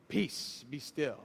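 whispered peace, be still; calm the storm softly; hushed, solemn command. Retold or imitated. Jesus stilling the storm in a hushed command.

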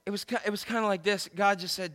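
ordinary talk, nothing marked

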